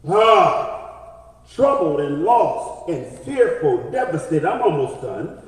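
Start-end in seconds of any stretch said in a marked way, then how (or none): none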